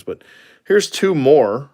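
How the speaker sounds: usual speaking voice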